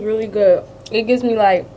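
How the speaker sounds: talking while chewing chip; mouth full, chewing. Real, not acted. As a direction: hand over mouth chewing. Seen 4: Hand covers her mouth while talking, likely chewing a chip.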